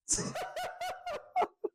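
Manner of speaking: a sudden, very high burst of laughter. Real, not acted. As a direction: break into a squeaky laugh at your own joke. Laughs after joking "pause.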